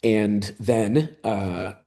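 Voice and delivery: ordinary talk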